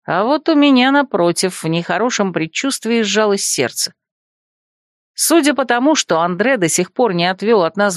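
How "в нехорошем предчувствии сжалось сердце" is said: usual speaking voice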